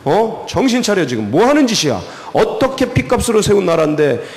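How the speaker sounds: high, indignant scolding that eases down at the end; acted rebuke. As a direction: tear into him as an outraged elder would. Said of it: "막 그러면서 이거 책망하는 거예요".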